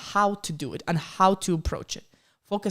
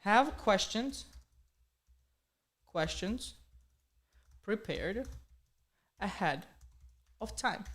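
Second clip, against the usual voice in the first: word by word, long pauses, softer; slow dictation; 6 dB quieter, slower than usual. Real, not acted. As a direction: dictate as you write it down. Dictating while writing it down.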